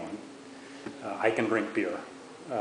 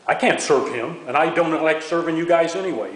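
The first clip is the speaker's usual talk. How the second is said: higher, louder, dismissive refusal; snippy bartender; 6 semitones higher, 8 dB louder than usual. Retold or imitated. Bartender refusing to serve the returning soldiers, scornful. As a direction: brush off the soldiers like they disgust you. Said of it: "this lady's going".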